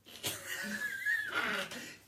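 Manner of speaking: high, voiceless burst of laughter; imitated cracking up. Man looks back after being spat out, imitated high laughing fit. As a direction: act out helpless wheezy laughing. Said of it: "he cracked up".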